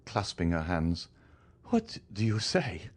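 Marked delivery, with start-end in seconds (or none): 1.7-2.9 higher, slow, anxious woman's voice; a wife pleading in fear; frightened woman voice. Acted.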